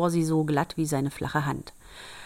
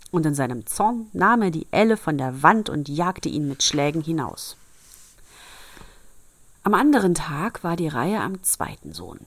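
ordinary delivery

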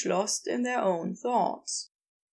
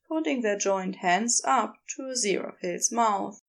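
plain reading voice

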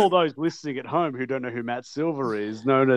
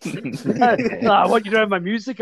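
mock Sandman; 6 semitones higher than usual. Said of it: "retold Sandman quote".

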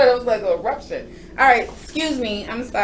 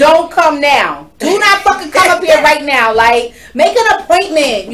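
yelling across the room; 4 semitones higher, 7 dB louder than usual. Real, not acted. Warning people not to come.

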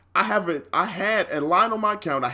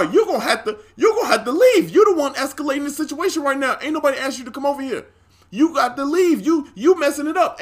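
raised, high-pitched indignant telling-off; retold confrontation; 8 semitones higher, 3 dB louder than usual. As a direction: order him out, voice climbing high. Retold confrontation telling manager to leave.

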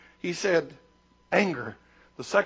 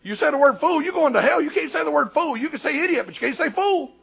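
put-on higher, louder voice of a scandalized child; raised-pitch imitation of childish alarm; literal-minded kid; 7 semitones higher, 4 dB louder than usual. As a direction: mimic a kid laying down the rule. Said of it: "you know, and I think".

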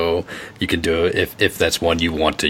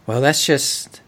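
much higher, delighted woman's voice; flattered lady; 6 semitones higher than usual. Acted. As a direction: play the lady charmed and glowing. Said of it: "just a big smile, she's glowing".

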